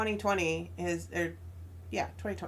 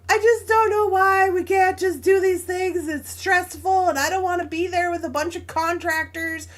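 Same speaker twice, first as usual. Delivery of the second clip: tearful, higher and louder plea, retelling her cry; retold tearful plea; 8 semitones higher, 7 dB louder than usual. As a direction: voice breaking as she relives the fight, pitched up. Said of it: "and so I'm crying".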